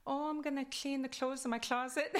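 put-on high-pitched Kwakwala-accented English. Telling a friend today's plans, in a Kwakwala accent. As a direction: lean into the Kwakwala accent, amused. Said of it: "how I knew I had an accent".

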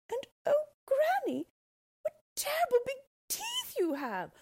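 put-on high, loud, alarmed child's cry; a small girl suddenly scared of the teeth; frightened little girl.

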